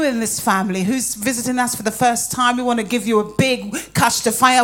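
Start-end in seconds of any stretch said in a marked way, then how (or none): none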